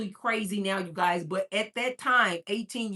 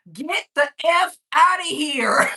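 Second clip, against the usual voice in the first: loud, high, drawn-out playful holler; mock shout; 5 semitones higher, 9 dB louder, slower than usual. Host to an underage viewer, mock shout. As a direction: holler it as a joking order. Playful echo of the screaming man.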